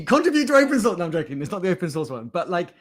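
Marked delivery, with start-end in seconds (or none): 0.0-1.0 high, loud shouted slogan; mock rallying cry. Host jokingly urging viewers to contribute, mock shout. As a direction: belt it out as a joke call to action. Joke slogan called out to audience.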